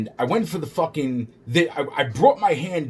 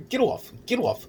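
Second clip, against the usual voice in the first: high-pitched repeated mock plea; retold protest; 9 semitones higher than usual. Himself to the officer holding him, high retold protest. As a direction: reenact pushing someone away, pitched well up.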